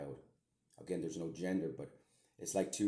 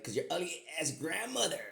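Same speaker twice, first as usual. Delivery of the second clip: re-enacted insult; 11 semitones higher, 3 dB louder than usual. Man retelling what he said about the grandmother, mocking. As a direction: throw the insult out with pitch way up.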